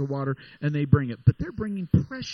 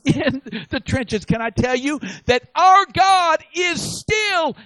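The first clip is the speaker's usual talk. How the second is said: loud, high, slow climactic preaching; preaching at full force; 10 semitones higher, 7 dB louder than usual. Acted. Preacher thundering to his congregation, full-force high loud climax. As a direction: build to a roaring climax.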